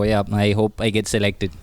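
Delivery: normal talking voice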